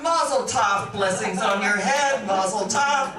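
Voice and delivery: loud, jokey blessing; mock hearty congratulations. Speaker joking about the bird's deposit, mock-celebratory cheer. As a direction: proclaim a comic blessing loudly.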